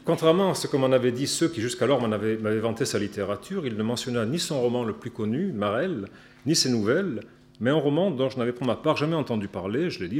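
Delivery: usual speaking voice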